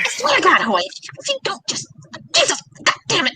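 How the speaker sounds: high-pitched, broken mock fury; hammed-up grandma fit, words choking out; imitated sputtering anger. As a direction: sputter as if too angry to finish. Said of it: "she would get so angry".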